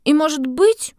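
put-on high, resolute girl voice; sigh, then insist like a child; stubborn little girl. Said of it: "с глубоким вздохом произнесла".

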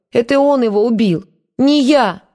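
high, loud tearful cry; sobbing outburst. A narrator's reading. Eva crying out her innocence, sobbing loud and high. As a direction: wail it out through sobs. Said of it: "всхлипнула Ева".

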